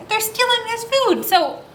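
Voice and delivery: usual speaking voice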